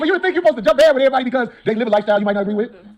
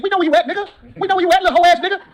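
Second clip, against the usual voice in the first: higher-pitched, heated taunt; confrontational, raised pitch; taunting threat; 10 semitones higher, 4 dB louder than usual. Acted. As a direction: call out the troll directly. Calling out an absent troll.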